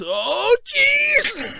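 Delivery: high strained cry of effort; groaning shout near the top; climbing stairs. Acted. Interleaved stair-climbing skit.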